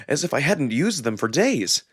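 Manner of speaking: ordinary delivery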